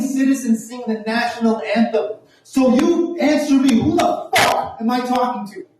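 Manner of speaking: high, heated tirade; full confrontation mode. Retold or imitated. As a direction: rant indignantly at the cops, building to a demand. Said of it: "this is when I go full".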